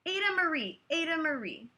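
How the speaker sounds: high, loud scolding call of her name; stern full-name scolding; scolding parents. Acted. Parents scolding their daughter.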